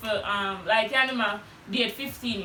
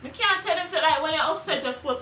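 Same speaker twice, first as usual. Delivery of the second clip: ordinary talk, nothing marked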